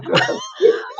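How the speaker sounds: shrill high squealing laugh, mock amusement. Medium acting the spirit's laugh at the joke, high giggle.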